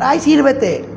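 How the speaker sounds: high, loud, brushing-off sigh; dismissive wife. Acted. Wife brushing off her tired husband, high and annoyed. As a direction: wave him off like a fed-up wife.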